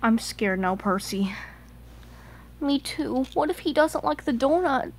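ordinary talk

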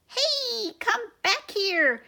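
high squeaky call, slow; shouting mouse. Acted. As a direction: call the troops back in a squeak. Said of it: "shouted the Big Cheese".

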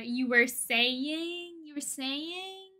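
drawn-out, very slow teasing prompt; friends teasing. Retold or imitated. Friends teasing the streamer, very slow and drawn out. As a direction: stretch it out like a friend needling. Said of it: "My friends are like".

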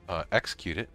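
usual speaking voice

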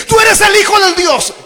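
high, loud fearless cry; bold declaration. Acted. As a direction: proclaim it like fearless Peter. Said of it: "se levanta como el más intrépido y dice". (Pedro proclamando con audacia a gritos)

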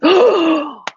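loud, high excited gasp; hyperventilating gasp. Real, not acted. Presenter loving the new paper, excited gasp. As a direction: suck in air, overwhelmed with delight. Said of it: "hyperventilating. I'm loving this".

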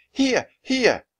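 high, loud repeated call; crying out. A narrator's reading. Annie guides Jack to the treehouse, crying out. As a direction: call them out sharply to guide him. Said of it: "cried Annie".

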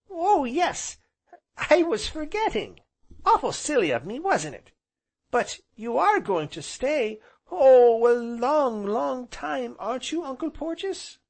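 put-on very high, loud child voice; eager small boy. A narrator's reading. Small boy hoping uncle will stay, high and eager. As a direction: gush high like an excited little boy.

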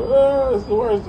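high, slow mock wail; hammed-up sob-voice; mock crying. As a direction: ham up a crying stranger's whine. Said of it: "seen old boy crying".

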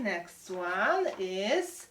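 "Next one is" stretched very slowly; suspenseful slow. Shown as a demonstration. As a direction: drag it out to build suspense. Teacher builds suspense before word.

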